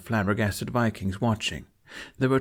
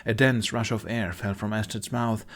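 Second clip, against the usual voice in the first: usual speaking voice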